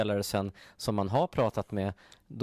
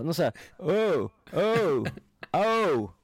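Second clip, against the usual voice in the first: high, louder mock grunting; imitating guard mimicking a sound; 8 semitones higher, 6 dB louder, slower than usual. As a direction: ham up the guard copying the odd noise. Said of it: "Han bara". (härmar vakten som härmar ljudet)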